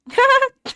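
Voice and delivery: high loud burst of laughter. Real, not acted. Mocking a character, high laugh. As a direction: crack up at him.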